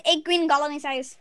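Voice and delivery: quick run of eight green gall any size; fast. Shown as a demonstration. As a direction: rattle it off fast. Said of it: "say it real fast".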